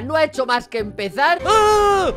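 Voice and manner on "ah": sudden high, loud scream; startled scream. Real, not acted. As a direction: cry out in alarm at a jump scare. Algo sale corriendo en el juego. (grito agudo de susto)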